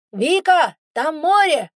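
high, loud excited yell; shouting over wind. A narrator's reading. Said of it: "перекрикивая ветер".

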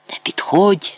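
high, whispery hiss; sharp hissed warning. A narrator's reading. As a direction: hiss it as a cornered threat. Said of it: "засичав грек до німих".

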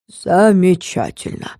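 slow, deadpan oracle; unsmiling confirmation. A narrator's reading. Oracle grimly confirming the news, deadpan. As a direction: draw the word out without a trace of a smile. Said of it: "подтвердил оракул без тени улыбки".

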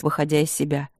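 plain reading voice

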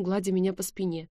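ordinary delivery, nothing marked

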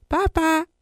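high chirpy goodbye; sing-song bye. Acted. Father leaving with a high chirpy goodbye. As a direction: toss off a bright farewell. Cheery farewell.

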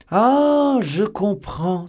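very high, loud, drawn-out cry of wonder; amazed exclamation. A narrator's reading. Porthos finally grasps the trick, amazed exclamation. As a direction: marvel aloud as it suddenly dawns on you. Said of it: "s'écria Porthos émerveillé".

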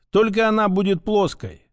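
ordinary delivery

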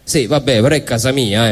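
usual speaking voice

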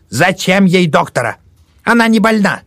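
high shrill-free loud scolding; scolding old woman. Acted. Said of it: "громко принялась браниться". (громкая брань старухи)

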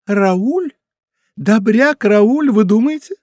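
high, loud, disbelieving question; incredulous. A narrator's reading. Montalais doubts that good Raoul would kill, incredulous. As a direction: pitch it up in astonished doubt.

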